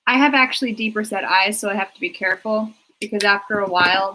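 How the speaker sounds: normal talking voice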